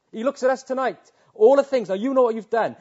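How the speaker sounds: high and loud, pressing the congregation; impassioned preaching. Real, not acted. Preacher confronting the congregation with their deeds, high and loud. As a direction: preach it straight at them.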